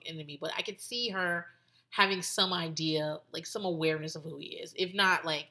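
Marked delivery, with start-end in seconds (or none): none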